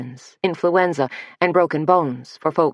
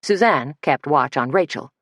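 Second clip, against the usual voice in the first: ordinary delivery, nothing marked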